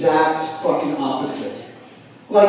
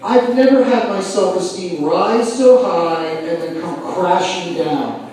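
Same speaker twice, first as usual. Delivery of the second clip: ordinary talk, nothing marked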